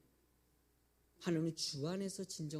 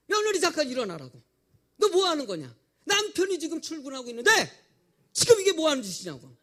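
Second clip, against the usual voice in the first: loud, much higher furious rebuke; thunderous scolding; 8 semitones higher, 13 dB louder than usual. Retold or imitated. Mother-in-law wakes her sleeping daughter-in-law, roaring scolding. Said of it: "호통을 치듯이".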